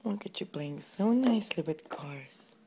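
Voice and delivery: soft whispered praise; whispering. Real, not acted. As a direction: whisper it gently so he keeps playing. Whispering praise to playing toddler.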